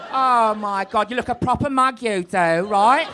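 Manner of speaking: loud Essex police captain; high, punchy Essex accent on a drug bust; broad Essex, bawling out a suspect. Acted. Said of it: "but the captain's from Essex".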